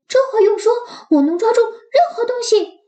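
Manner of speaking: high, eager puppy voice; yappy panting puppy, thrilled. Acted. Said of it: "Max激动地一跃而起". (激动兴奋的小狗)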